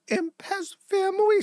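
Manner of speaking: very high, squeaky mock imp voice; mock her with a tiny, squeaky creature voice; squeaky house-elf impression; Dobby. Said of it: "She's talking like Dobby".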